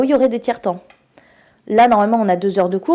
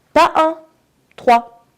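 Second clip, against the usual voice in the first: louder, higher on "Pas un", then "trois" hammered; indignant, loud "trois"; indignant emphasis; 8 semitones higher, 6 dB louder, much slower than usual. Real, not acted. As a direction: stress that it is three, not one. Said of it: "L V trois".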